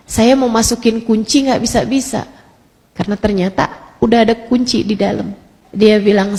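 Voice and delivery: usual speaking voice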